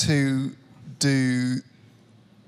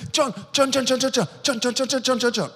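repeated sing-song call, much higher; calling out a name; 7 semitones higher, faster than usual. Retold or imitated. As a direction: keep calling him as you push through the crowd. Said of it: "walking through everyone going".

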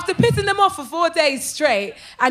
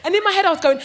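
usual speaking voice

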